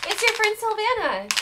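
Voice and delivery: high loud bright announcement; spring the surprise; loud high happy reveal; excited reveal. A narrator's reading. Friend appears at night.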